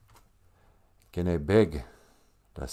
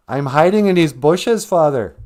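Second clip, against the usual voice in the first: high, loud guilty confession; sheepish Adam; 5 semitones higher, 7 dB louder than usual. Acted. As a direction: blurt it out like a caught child. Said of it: "Adam says".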